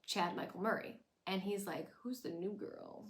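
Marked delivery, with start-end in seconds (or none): 2.0-3.0 predatory flirt. Retold or imitated. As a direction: lean in like a smug heartthrob. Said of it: "he's being all predatory".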